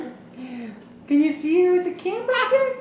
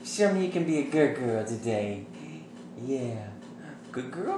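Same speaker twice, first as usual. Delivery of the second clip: much lower and softer, coaxing; 14 semitones lower, 11 dB quieter than usual. Real, not acted. Dad talking to baby.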